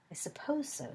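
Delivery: light, amused whisper. A narrator's reading. Remus accepting the marauders name in an amused whisper. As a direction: breathe it out with a hidden smile. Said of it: "said Remus, amused".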